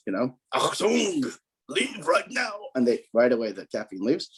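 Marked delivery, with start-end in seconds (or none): 0.5-2.5 high, barked mock German accent; thick German accent. Caffeine ordered to leave in a hammed-up German accent. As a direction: play a gruff German officer ordering the caffeine out. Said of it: "in a thick German accent".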